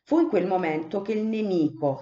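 ordinary delivery

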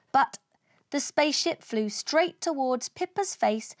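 usual speaking voice

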